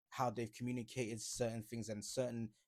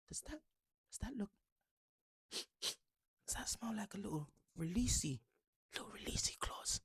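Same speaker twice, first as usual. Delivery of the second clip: sly mock whisper; 7 semitones higher, 4 dB quieter than usual. Mocking Goldbridge's tweet.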